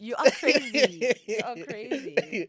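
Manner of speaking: laughing. Real, not acted. Laughing at friend's joke.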